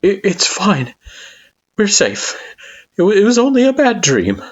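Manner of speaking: high, gentle soothing voice; calm them after a nightmare; soothing Kara voice. A narrator's reading.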